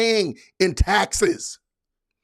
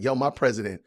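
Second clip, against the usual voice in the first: sarcastic mock fan; 5 semitones lower, 3 dB quieter than usual.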